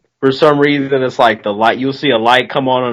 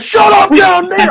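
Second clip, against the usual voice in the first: much higher, louder mock yell; angry neighbors yelling; 5 dB louder than usual. Neighbors woken at one in the morning, yelling down. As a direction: bellow it down from a window. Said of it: "They were like".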